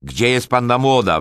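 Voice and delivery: loud, high impatient yell; wrzask. A narrator's reading. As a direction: shout it when nobody answers. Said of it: "wrzasnął". (głośny, niecierpliwy wrzask)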